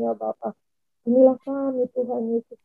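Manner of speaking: ordinary talk, nothing marked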